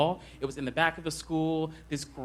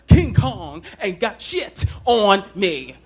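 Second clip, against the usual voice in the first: slow, loud, swaggering movie line; Training Day tough-guy roar; Denzel Washington impression; 5 semitones higher, 10 dB louder, slower than usual. Shown as a demonstration. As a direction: growl it out like a tough cop. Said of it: "I tried to do Denzel Washington in Training Day".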